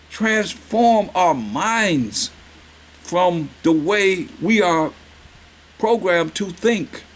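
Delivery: slow, deliberate preaching; slow emphasis. Real, not acted. Preacher urges congregation to change, slow and deliberate. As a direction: drag each word out for weight. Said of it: "slow preaching emphasis".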